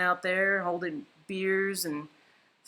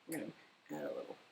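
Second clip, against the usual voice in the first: whispering; 7 semitones lower, 16 dB quieter than usual. Real, not acted. Concentrating while painting.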